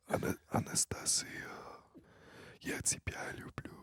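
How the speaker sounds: hushed, intimate Russian; whispered Russian. Shown as a demonstration. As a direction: whisper "Anastasia" and "Я тебя люблю" in Russian. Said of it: "how you say it in Russian".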